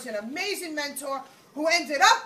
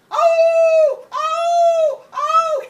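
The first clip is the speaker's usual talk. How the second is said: high, loud, long dog howls; howling dog; 14 dB louder, much slower than usual. Acted. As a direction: wail like a dog in pain.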